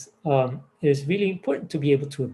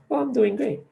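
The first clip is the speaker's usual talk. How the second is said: surprised and pleased; 9 semitones higher than usual. Retold or imitated. Cashier surprised out of script.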